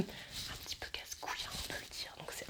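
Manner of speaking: whispered cheeky aside. Real, not acted. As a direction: drop to a whisper for the rude bit. Whispering a rude word aside. (chuchoté, en aparté)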